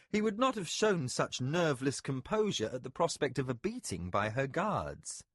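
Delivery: ordinary delivery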